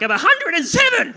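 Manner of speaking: high, very loud mock old-woman outburst; imitated shouting. Comic as an old woman grabbing his wrist, imitated shouting.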